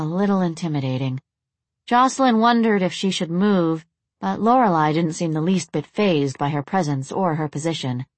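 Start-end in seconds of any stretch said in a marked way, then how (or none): none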